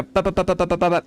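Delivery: evenly tapped staccato syllables. Shown as a demonstration. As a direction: fire off each syllable separately. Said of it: "there's a staccatic nature to it".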